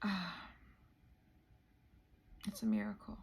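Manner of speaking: overwhelmed, barely voicing it; choked-up whisper. Real, not acted. Vlogger moved to tears by her own journey, whispering. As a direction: voice dropping to a whisper from emotion. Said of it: "the gravity of this is hitting me".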